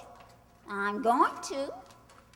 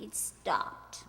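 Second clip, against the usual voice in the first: softer hushed whisper; 3 semitones lower, 7 dB quieter than usual. Acted. Tracy tells Mike the phone has gone quiet, hushed whisper. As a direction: breathe it out, listening.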